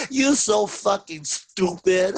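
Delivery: slow, high, giggling mockery; laughing taunt. Therapist laughing at angry client, slow mocking taunt. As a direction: tease him through laughter.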